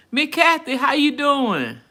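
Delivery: loud, high, stretched-out welcome; real delighted shout-out; excited greeting. Real, not acted. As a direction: call out to a friend just arriving. Calling out greeting to viewer joining.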